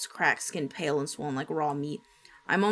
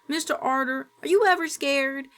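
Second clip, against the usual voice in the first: put-on young girl; 8 semitones higher, 3 dB louder than usual. Young girl asks grandpa.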